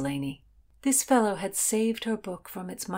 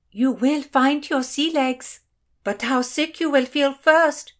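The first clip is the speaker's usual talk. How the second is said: excited welcome; 8 semitones higher, 7 dB louder than usual. A narrator's reading. Ladies swarm the newcomer on deck, loud high chatter. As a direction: proclaim it loudly to the newcomer.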